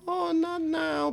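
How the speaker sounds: high, slow mock wife voice; imitated wife. Mimicking a wife turning down her husband, high and slow. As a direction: put the monster off in a drawn-out high voice. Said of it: "And she's like".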